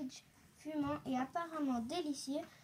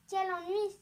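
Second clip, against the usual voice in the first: higher, louder cry of annoyance; vexed exclamation; 5 semitones higher, 5 dB louder than usual. A narrator's reading. Boucle d'Or fallen on the floor, exclaiming. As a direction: exclaim in mild vexation. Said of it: "s'exclama-t-elle".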